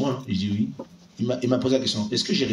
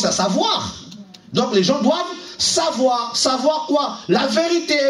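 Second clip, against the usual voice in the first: louder, rising sermon delivery; fervent preaching; 5 semitones higher, 3 dB louder than usual. Acted. Preacher exhorting the church, full-force preaching. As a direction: preach it hard to the congregation.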